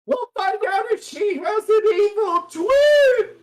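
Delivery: very high dramatic lady voice; posh lady. Acted. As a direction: wail it like a soap fan. Lady recalls her soap opera.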